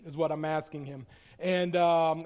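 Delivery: usual speaking voice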